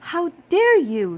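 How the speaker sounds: high, very loud outraged cry; soaked guest shouting in outrage; furious shout. A narrator's reading. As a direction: blast her fury. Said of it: "she shouted".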